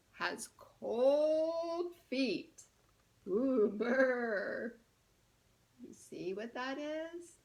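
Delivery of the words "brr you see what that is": plain reading voice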